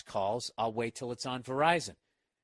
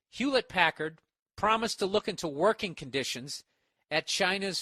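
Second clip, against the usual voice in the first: news read; 4 semitones higher, 4 dB louder than usual. Acted. Reading news article aloud.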